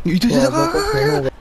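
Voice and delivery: sharp high cries of pain; hit hard crying out. Real, not acted. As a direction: yelp in real pain. Wrestling game, character hit.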